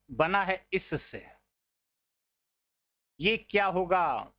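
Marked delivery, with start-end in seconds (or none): none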